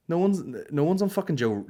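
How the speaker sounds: usual speaking voice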